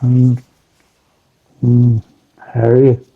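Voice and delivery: slow Northern Irish drone, barely moving in pitch; monotone Northern Irish. Shown as a demonstration. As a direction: lightly imitate a monotone Northern Irish greeting. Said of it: "some of them are quite monotone".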